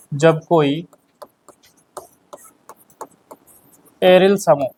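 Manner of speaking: teacher reading notes aloud, one word at a time. Acted. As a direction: dictate it to the class so they can write along.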